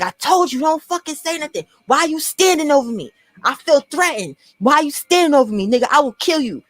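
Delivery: mock threat pitched high, half-acted. Retold confrontation, high.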